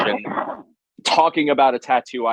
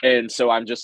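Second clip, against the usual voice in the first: usual speaking voice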